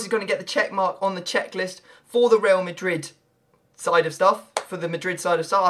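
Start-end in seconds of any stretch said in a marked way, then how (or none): none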